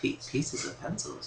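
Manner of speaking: soft unvoiced disbelief; incredulous whisper. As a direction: breathe it out in disbelief. Mocking Yoshi's wording.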